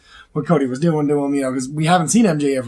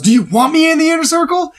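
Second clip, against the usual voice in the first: lightly voiced MJF, pitched much higher; mock MJF; 12 semitones higher, 4 dB louder than usual. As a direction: toss the question back, mock-high. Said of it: "And M J F goes".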